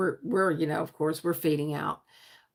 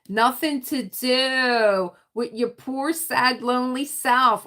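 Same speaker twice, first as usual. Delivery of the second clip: loud, high, drawn-out teasing; hammed-up poor-you wail; mock pity; 6 semitones higher, 6 dB louder, slower than usual. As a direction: stretch it out in exaggerated pity.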